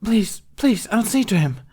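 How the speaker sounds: beg, frightened and high; begging girl. A narrator's reading. Slave girl begging the Hutt, high and frightened. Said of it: "the girl begged".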